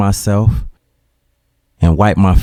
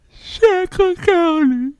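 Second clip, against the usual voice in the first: choked answer; much slower than usual. Retold or imitated.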